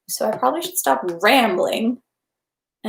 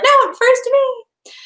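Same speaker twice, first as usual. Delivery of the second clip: mock zealot; 5 dB louder than usual. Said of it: "someone else is like".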